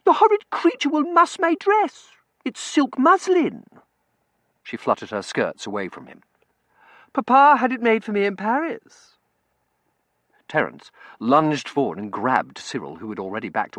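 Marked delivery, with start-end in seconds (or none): 0.0-3.6 put-on very high, loud girlish distress; high-pitched, fussy outrage; squealing complaint. A narrator's reading. Girl squeals about her dress. 7.1-9.2 high, loud spoilt whine; high-pitched petulant fussing; fussy girlish complaint. A narrator's reading. Girl fusses over her dress.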